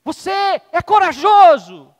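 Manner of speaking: high, very loud, slow call; proud shout. Acted. Father shouts again to show the echo, very loud slow call. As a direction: shout it out to the hills. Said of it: "Vou fazer de novo".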